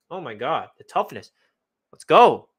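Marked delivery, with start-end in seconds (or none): 1.9-2.5 louder hype shout; real fan cheer for the quarterback; cheering let's go. Real, not acted. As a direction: fire up like a fan.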